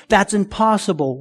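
normal talking voice

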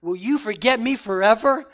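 loud, pleading cry to God; impassioned lament. Acted. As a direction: call out like a man desperate for an answer. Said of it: "David says".

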